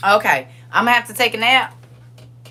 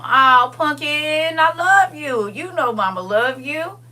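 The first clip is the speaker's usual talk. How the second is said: tender; 6 semitones higher, slower than usual. Real, not acted. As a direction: soothe her gently like mama would. Tender reply to emotional viewer.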